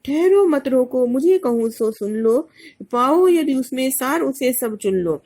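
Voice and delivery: Kaikeyi's defiant plea, recited loud; declaimed verse. Acted. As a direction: declaim it as Kaikeyi demanding to speak. Kaikeyi demands to be heard.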